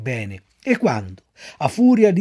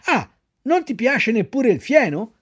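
higher, snapping at the donkey; angry shout; 7 semitones higher than usual. A narrator's reading. The master scolding Pinocchio over the hay, angry. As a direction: bark it out in a temper. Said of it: "gridò il padrone imbizzito".

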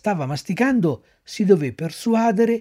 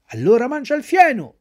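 gruff master; 8 semitones higher, 5 dB louder than usual. A narrator's reading. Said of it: "replicò il padrone".

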